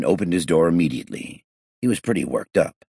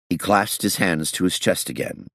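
plain reading voice

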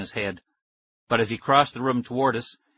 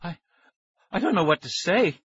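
put-on breathless girl; 7 semitones higher than usual. A narrator's reading. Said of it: "Tara confessed".

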